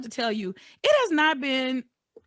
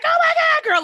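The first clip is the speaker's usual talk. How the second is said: much higher, much louder squeal of greeting; loud excited scream; 9 dB louder than usual. Retold or imitated. Imitating young ladies at the airport, loud excited shriek. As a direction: shriek it like friends reuniting. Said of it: "when we make noise".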